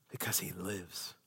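low, slow near-whisper; hushed refrain. Real, not acted. Preacher answering why turn from sin, hushed refrain. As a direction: answer very softly and slowly.

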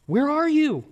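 pleading outcry of the psalmist; anguished cry. Retold or imitated. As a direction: let it break out of you in desperation. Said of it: "And he means it".

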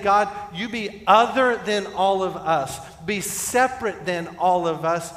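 loud, high, insistent appeal to God; fervent prayer. Acted. Preacher voicing the congregation's prayer, fervent. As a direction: press each plea home with force. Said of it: "And so we're saying".

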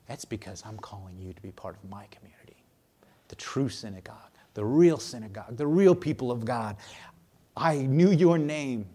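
quiet intimate voice of Jesus calling the rejected man; tender Jesus voice. Acted. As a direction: lean in and whisper it like a secret comfort. Jesus speaking tenderly to outcast.

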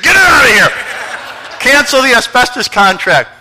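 loud, slow firm command from the pulpit; emphatic order. Retold or imitated. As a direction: tell them to get it out, firm and loud. Retold order to cancel.

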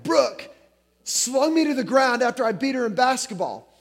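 upset son. Retold or imitated. Upset son accuses sister.

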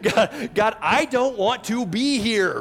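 high, loud, laughing plea; mock desperate prayer. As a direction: ham up a whiny prayer from prison.

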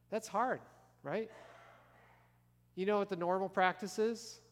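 normal talking voice

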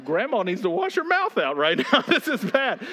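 half-laughing mock scolding; laughing inner thought. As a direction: chuckle while thinking it. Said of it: "I think".

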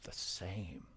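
hushed whisper, almost no voice. Real, not acted. Preacher whispering the shocking answer to the congregation.

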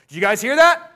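mock drill-sergeant preacher urging the crowd; rousing pulpit push. Shown as a demonstration. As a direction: drive them forward like a coach yelling from the sideline. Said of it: "I'm not trying to sound like a drill sergeant".

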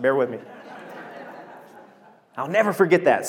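ordinary talk

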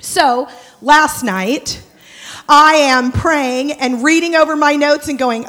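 ordinary delivery

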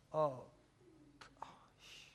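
very soft, falling to a whisper; hushed dismay. Acted. Peter faces the risen Jesus after betraying him, hushed dismay. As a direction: breathe it out, barely audible.

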